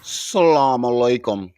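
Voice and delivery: slow, drawn-out "Salaam alaikum" in a put-on voice; mocking imitation.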